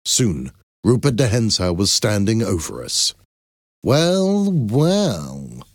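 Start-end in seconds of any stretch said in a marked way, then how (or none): 3.8-4.5 exclaimed. A narrator's reading. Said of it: "he exclaimed". 4.5-5.6 second well dropped into a gloating tone; mock-pleased villain savoring the moment; sly drawn-out villain's well. A narrator's reading. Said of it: "he exclaimed".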